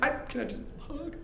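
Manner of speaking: put-on high, small little-girl voice. Starstruck fan asking [name] for a hug, as a little girl. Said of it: "I'm like".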